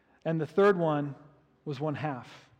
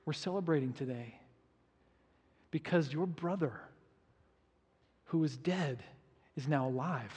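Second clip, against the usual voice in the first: soft, hushed and gentle; a father quietly reassuring his angry son; tender father; 2 semitones lower, 8 dB quieter than usual. Retold or imitated. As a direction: speak it softly, full of love. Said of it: "the dad goes".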